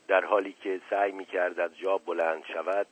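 usual speaking voice

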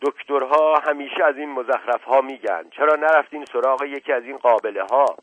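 loud, sharp scolding of doctors; sharp angry tone. A narrator's reading. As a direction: snap at her with impatience. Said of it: "دایجان با لحن تندی گفت".

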